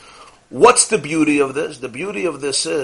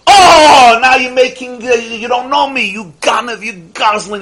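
high, loud scornful shouting; indignant yelling; 8 semitones higher, 9 dB louder than usual. Retold or imitated. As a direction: yell insults like he's caught a liar. Man keeps yelling, calls him names.

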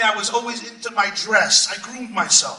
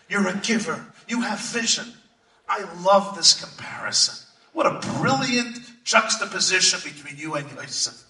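ordinary delivery

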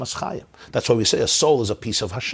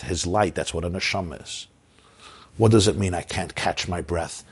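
ordinary talk, nothing marked

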